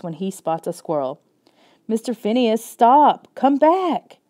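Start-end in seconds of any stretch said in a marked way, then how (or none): 1.9-4.2 frantic shouting. Acted. Chasing runaway dog.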